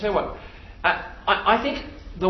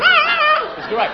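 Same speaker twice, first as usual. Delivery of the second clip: mock Justin falsetto. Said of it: "that's what [name] sounded like".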